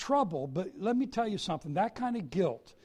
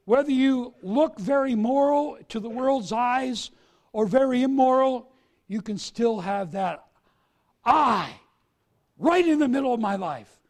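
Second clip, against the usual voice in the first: loud, rising sermon build; preaching at full force; 5 semitones higher, 8 dB louder than usual. Acted. Preacher warning the church about self at the centre, full-force preaching. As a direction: press the congregation hard.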